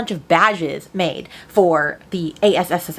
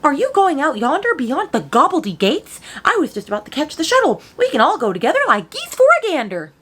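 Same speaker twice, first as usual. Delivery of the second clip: mocking quote; 8 semitones higher, 6 dB louder than usual. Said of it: "Who talks like that?".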